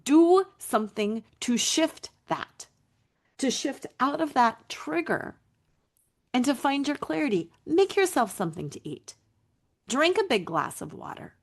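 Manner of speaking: ordinary delivery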